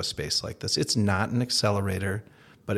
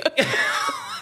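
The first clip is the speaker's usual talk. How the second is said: laughing. Real, not acted. Laughing at own story.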